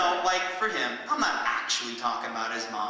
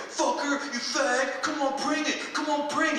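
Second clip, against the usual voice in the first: higher, mock drunk taunt; 11 semitones higher than usual. Imitating the drunk frat guy challenging him, higher taunt. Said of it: "He's like".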